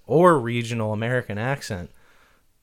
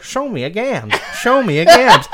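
put-on high, loud, laughing old-timey catchphrase; old-timey mustached fighter; 9 semitones higher, 8 dB louder than usual. Imagining a mustached dandy fighter taunting, hammed-up old-timey voice. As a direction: ham up a mustached dandy throwing a punch. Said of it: "a guy with high-waisted pants and a twirly mustache".

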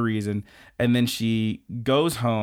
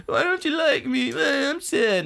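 high, whiny mock-sad voice; high whiny rejected suitor; 14 semitones higher than usual. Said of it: "He's like".

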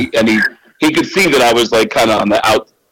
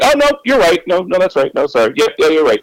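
flustered backpedaling; 7 semitones higher, faster than usual. Retold or imitated. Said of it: "And I was like".

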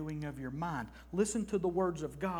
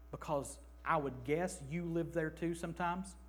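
normal talking voice

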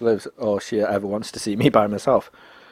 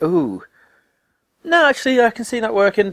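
pleasantly surprised; 11 semitones higher than usual. Retold or imitated. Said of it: "you're like".

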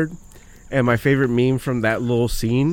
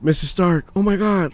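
higher-pitched awed greeting; excited Peter Parker; 7 semitones higher than usual. Retold or imitated. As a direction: blurt it out like a relieved, amazed kid. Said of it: "and he's like".

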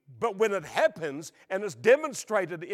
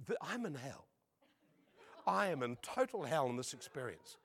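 muted dismay; 3 semitones lower, 8 dB quieter than usual. Retold or imitated. Recalls his dread while prayed over.

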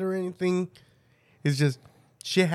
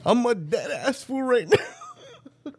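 laughing; 5 semitones higher, slower than usual. Real, not acted. Laughing after sour gulp.

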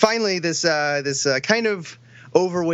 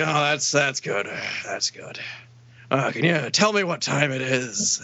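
put-on low old man voice; Bert; 7 semitones lower than usual. Old man asking coworker the time, creepy old man voice. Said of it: "there's this old guy who works there".

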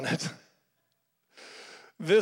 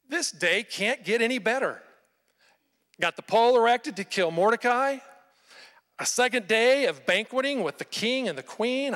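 higher, gleeful self-satisfied inner voice; gloating thoughts; 6 semitones higher, 3 dB louder than usual. Retold or imitated. Haman gloating to himself, higher and gleeful. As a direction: Haman savoring his perfect day. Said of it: "can you imagine what he's thinking".

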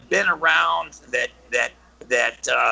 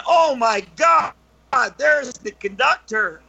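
mock-alarmed cry, higher and louder at the start; alarmed kids; 7 semitones higher, 3 dB louder than usual. Kids spotting a figure in the tunnel, mock alarm.

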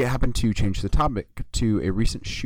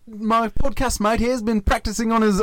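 high mock-Aussie praise; exaggerated Aussie co-host; 11 semitones higher, 4 dB louder than usual. Co-host praising his mate's accent, exaggerated Aussie voice. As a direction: push the put-on accent high.

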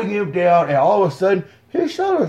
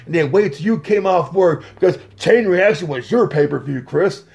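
usual speaking voice

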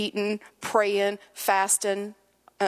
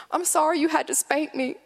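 put-on high-pitched child apology; a four-year-old's plaintive voice, imitated; little boy; 8 semitones higher than usual. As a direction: apologize like a small kid to mom. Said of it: "He's probably four".

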